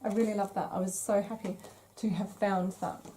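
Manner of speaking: ordinary delivery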